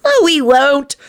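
put-on high little-kid correction; high, matter-of-fact child; practical child. A narrator's reading. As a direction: contradict calmly like a small child. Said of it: "responded in a practical voice".

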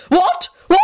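high-pitched, outraged mock shriek. A narrator's reading. Lady Fanshawe reacting to an accusation, shrieking. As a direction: shriek it in scandalised disbelief, voice shooting up. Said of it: "she shrieked".

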